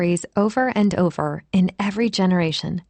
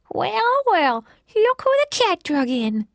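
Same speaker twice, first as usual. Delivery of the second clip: put-on high, lisping old lady voice; lisping old woman; 13 semitones higher, slower than usual. A narrator's reading. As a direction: lisp it out like a nosy old woman. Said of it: "she said with a lisp".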